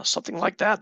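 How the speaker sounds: usual speaking voice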